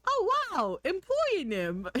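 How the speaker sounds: high, loud mock-amazed exclamation; mock amazement. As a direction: exaggerate your wonder, breaking into a laugh. Acts out own amazed misunderstanding.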